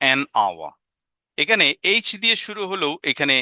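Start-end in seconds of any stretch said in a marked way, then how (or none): 0.1-0.7 "an hour" slowly, h dropped, an linked to our; an hour, silent h. Shown as a demonstration. Teacher showing the silent h in an hour. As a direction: say "hour" starting on the vowel. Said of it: "sound দিয়ে বিচার করতে হয়".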